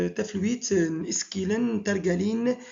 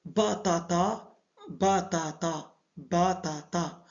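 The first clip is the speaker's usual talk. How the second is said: each syllable drawn out; slow word modelling; slower than usual. Shown as a demonstration. Teacher showing learners the word for potato, slow modelling. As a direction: say "Batata" slowly, syllable by syllable.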